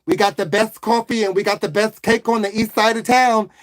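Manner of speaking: put-on Italian-American waitress; Italian woman. Waitress steering a customer away from Debbie, Italian woman's accent. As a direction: play a chatty Italian-American woman taking orders. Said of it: "Okay, an Italian woman".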